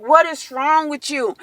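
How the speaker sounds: high, indignant retold demand; appalled confrontation. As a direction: confront him in disbelief. Acts out confronting the dentist.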